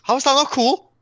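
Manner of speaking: high, loud outraged question; indignant disbelief. Real, not acted. Friend called his phone uncool, loud indignant protest. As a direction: defend it like it's obvious.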